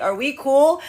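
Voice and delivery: hopeful question. Acted. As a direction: ask it like checking in with a friend.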